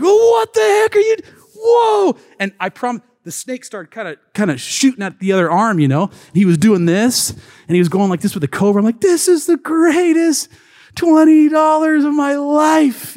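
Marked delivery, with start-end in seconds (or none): 0.0-2.2 high, loud yelp of alarm; retold panicked yell, much higher and louder; startled shout. Man strokes a live cobra. 9.0-13.2 high, loud and slowed on the last words; retold gleeful holler; excited shout. Thrilled after the snake show.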